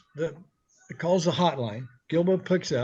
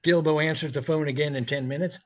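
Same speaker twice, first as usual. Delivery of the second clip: usual speaking voice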